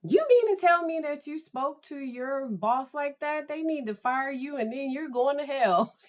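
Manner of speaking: high, incredulous lecture; scolding husband. Acted. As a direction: scold it like an appalled spouse. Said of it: "And he said".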